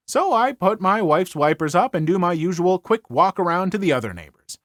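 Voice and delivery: ordinary delivery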